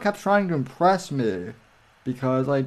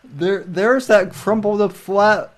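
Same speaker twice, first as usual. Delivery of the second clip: surprised; 6 semitones higher, 5 dB louder, slower than usual. Real, not acted. Spotting the flag on stream.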